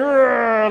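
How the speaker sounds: mock bodybuilder roar at full strain; muscleman's growl. Shown as a demonstration. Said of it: "he turned his back and he went".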